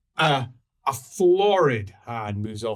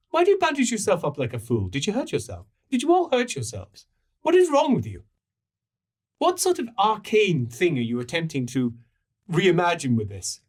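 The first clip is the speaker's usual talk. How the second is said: high, hurried barrage of questions; indignant pilgrim; 6 semitones higher, faster than usual. Acted. Pilgrim questioning bandaged strangers, indignant and high. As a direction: berate them in pitched-up disbelief.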